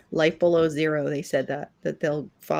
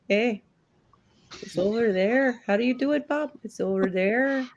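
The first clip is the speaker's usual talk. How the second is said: put-on higher Minnesota accent; hammy Minnesota accent; 6 semitones higher than usual. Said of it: "I love your, uh, Minnesota accent".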